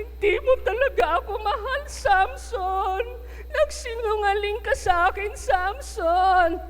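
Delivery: high, put-on sobbing plea; mock crying Delilah. Delilah crying at Samson that he does not love her, hammed-up sobs. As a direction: fake tears to guilt him. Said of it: "Umiyak siya".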